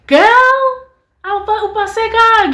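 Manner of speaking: high, loud, delighted shout; thrilled praise. Retold or imitated. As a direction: cheer her on in isiZulu, up high. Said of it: "she was like".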